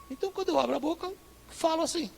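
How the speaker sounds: high, fast Northeastern Brazilian accent. Shown as a demonstration. Pastor showing the church his Northeastern Brazilian accent. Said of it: "falar com um sotaque nordestino".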